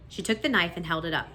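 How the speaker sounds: ordinary delivery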